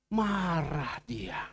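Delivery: drawn out slowly and low; slow, heavy. Shown as a demonstration. Pastor slowly stressing Jesus' anger, drawn out. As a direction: let it sink, slow and grave. Jesus angry at fig tree.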